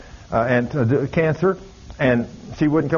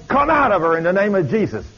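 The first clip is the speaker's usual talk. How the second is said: higher, forceful rebuke; shouted command; 6 semitones higher than usual. Retold or imitated. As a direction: drive the demon out with a raised voice. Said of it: "when I shook her".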